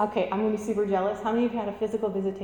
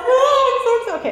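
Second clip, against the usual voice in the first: much higher, louder squeal of delight; mock gushing excitement; 11 dB louder than usual. Preacher imitating herself to congregation, squealing excitedly. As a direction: ham up your own giddiness. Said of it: "I'm like".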